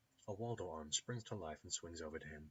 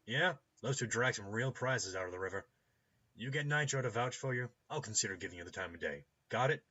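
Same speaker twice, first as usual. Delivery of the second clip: loud, unimpressed gatekeeper voice; brusque doorkeeper; 5 semitones higher, 8 dB louder than usual. Acted. Doorkeeper questioning a stranger over the intercom, brusque and loud. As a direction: challenge the stranger loudly and curtly.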